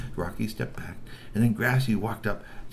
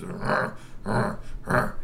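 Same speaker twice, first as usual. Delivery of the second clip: troll growl; much slower than usual. Acted. Grassy growling.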